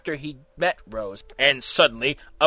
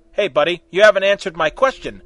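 growling; 2 semitones higher, 8 dB louder than usual. A narrator's reading.

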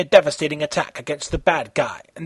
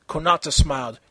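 ordinary delivery

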